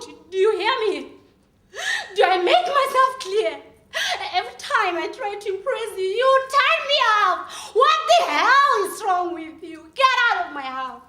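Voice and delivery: loud demanding rage; furious shouting. Acted. Throwing a boyfriend out of the house, furious. Acted quarrel, throwing him out.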